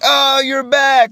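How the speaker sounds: high, drawn-out delighted welcome, imitated; grinning greeting. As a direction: imitate a coach beaming at someone's return. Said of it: "with a big grin on his face".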